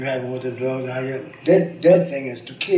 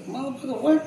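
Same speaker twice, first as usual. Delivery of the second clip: mock street tough; 12 semitones higher than usual. Retold street confrontation.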